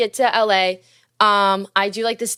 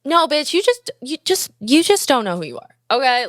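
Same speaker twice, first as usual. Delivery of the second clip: sassy retort; 4 semitones higher than usual. Retold or imitated. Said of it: "I was like".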